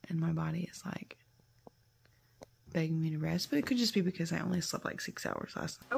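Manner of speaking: soft, hushed talk from bed; subdued, partly whispered morning chat; keeping quiet. Real, not acted. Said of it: "I'm being quiet so that Mabel doesn't wake up".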